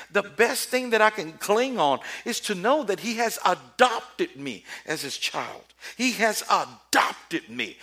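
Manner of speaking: high, loud, emphatic preaching; rising, loud, slowed sermon climax; full-force preaching. Real, not acted. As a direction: preach it with fire.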